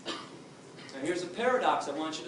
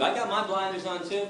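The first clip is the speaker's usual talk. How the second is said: ordinary talk, nothing marked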